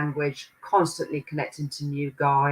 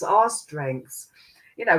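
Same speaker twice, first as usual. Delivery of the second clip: ordinary talk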